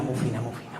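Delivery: mocking the posh daughter-in-law while laughing; laughing through the quote. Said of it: "Tengo una nuera que es que es muy fina".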